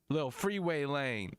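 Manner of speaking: normal talking voice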